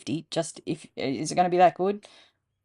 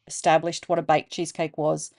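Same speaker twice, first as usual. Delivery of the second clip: usual speaking voice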